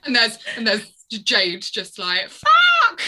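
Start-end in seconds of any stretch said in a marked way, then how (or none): 2.3-3.0 high, loud yelp of a curse; mock shouted swear. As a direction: imitate someone blurting it out in the background. Imitates Jade swearing in background.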